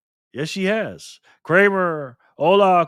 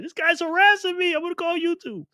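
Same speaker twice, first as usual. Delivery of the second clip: high squeaky mock woman's voice, much higher; mock Michelle; 14 semitones higher than usual. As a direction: tease with a falsetto imitation of her complaint. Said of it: "Michelle like".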